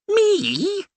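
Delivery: very high squeaked Me; a startled little man's squeak. A narrator's reading. The curate squeaking in alarm at the Reverend's plan, squeaky. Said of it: "squeaked the small man".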